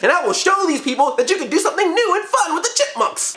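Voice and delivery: high, earnest, bright-eyed voice; high gung-ho writer voice; mock eager writer. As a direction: play the hopeful young writer. Voicing idealistic writer.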